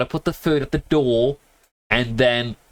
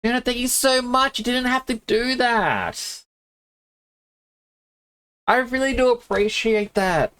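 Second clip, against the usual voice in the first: thanking Juno much higher, slowing on the middle line; thrilled streamer thanking a generous viewer; excited thanks; 9 semitones higher than usual. Real, not acted. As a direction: gush your thanks, overwhelmed. Streamer thanks a surprise gift.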